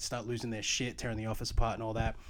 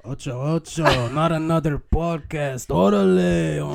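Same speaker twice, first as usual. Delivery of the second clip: put-on loud, drawn-out Mexican-accented announcing; 4 semitones higher, 10 dB louder, slower than usual. Co-host hypes the Mexican edition of the podcast, loud Mexican accent. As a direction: put on a big Mexican accent, building louder. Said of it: "the Mexican edition".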